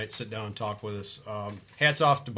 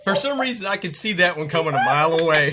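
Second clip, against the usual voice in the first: loud, raised-pitch exclamation; loud amused reaction; 6 semitones higher, 10 dB louder than usual. Real, not acted. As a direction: call it out over the laughter. Co-host reacts to the punchline.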